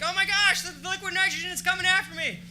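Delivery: high, loud, hammed-up alarm; mock terror at the fog; mock panic. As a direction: play a scared audience member freaking out. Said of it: "They're like".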